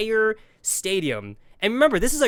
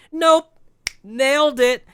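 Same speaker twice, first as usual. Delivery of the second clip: much higher, louder gloating; triumphant; 9 semitones higher, 7 dB louder, slower than usual. Real, not acted. Telling the audience he got away with it, loud triumph. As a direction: punch it like a victory cry.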